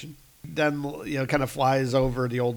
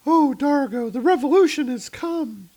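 much higher and louder, swooning; high girlish voice; 13 semitones higher, 6 dB louder than usual. Acted. Sala cuddles up to Dargo, high and swooning. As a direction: gush over Dargo.

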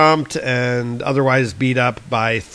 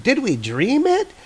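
put-on puzzled kid; 12 semitones higher than usual. Kid doubting what they saw, high puzzled voice. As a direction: wonder aloud like a confused kid. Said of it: "And it's like".

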